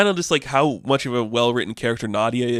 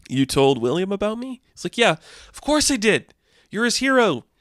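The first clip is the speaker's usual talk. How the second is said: high touched voice; 9 semitones higher than usual. Retold or imitated. Hank and Greer reunion.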